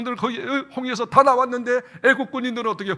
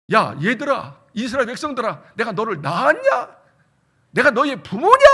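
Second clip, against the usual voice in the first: exasperated scolding; 2 semitones higher, 7 dB louder than usual. Retold or imitated. Moses scolding the complaining Israelites, loud and exasperated. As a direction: scold them like a worn-out leader.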